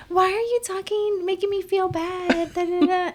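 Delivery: high whiny sister's complaint; mocking sister voice. Sister whining about feeling judged, high mock whine. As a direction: whine it back like a hurt younger sister. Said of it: "She'd be like".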